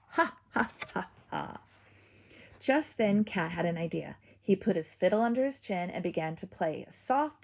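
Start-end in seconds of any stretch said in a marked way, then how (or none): 0.1-1.6 gloating wolf laugh; villain laugh. A narrator's reading. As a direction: laugh wickedly. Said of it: "Wolf laughed".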